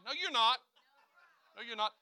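usual speaking voice

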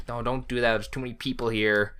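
ordinary delivery

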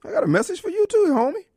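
mock taunt. Taunting Republicans, mock tough voice.

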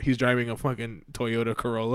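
ordinary talk

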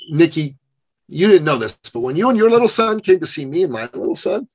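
normal talking voice

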